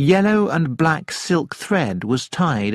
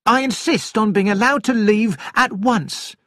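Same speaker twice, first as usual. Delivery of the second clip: indignant, much higher-pitched demand; Lady Horbury, angry; 9 semitones higher than usual. A narrator's reading. Lady demands to leave the plane, angrily. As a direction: insist crossly in a high voice. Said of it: "cried Lady Horbury angrily".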